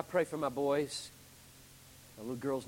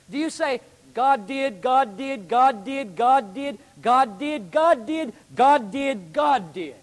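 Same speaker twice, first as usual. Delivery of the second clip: "God did" hammered louder each time; God-did refrain; 9 semitones higher, 12 dB louder, slower than usual. Shown as a demonstration. Preacher mocks a God-saturated retelling, hammering "God did". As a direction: repeat "God did" driving the word "God" hard every time. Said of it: "you don't usually talk like that".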